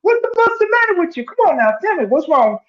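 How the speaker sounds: high concerned voice; voicing the boyfriend. Retelling the skit, boyfriend asks his girlfriend, high. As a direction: ask it high and worried. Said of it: "he's looking at her like".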